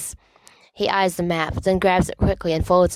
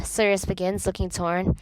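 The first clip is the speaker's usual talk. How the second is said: usual speaking voice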